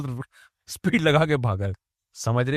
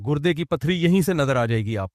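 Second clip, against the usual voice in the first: usual speaking voice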